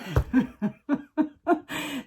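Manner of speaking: real high-pitched giggle; laughing. Real, not acted. Host cracking up at her own slip, laughing. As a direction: burst into a long, high laugh.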